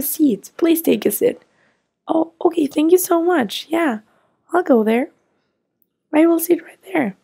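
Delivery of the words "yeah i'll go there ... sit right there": ordinary talk, nothing marked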